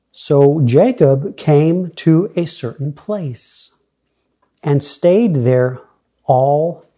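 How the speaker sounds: loud, deliberate reading aloud; scripture reading. Acted. Preacher reads Jacob's story to the congregation, loud deliberate scripture reading. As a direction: proclaim the verse slowly, then steadily onward.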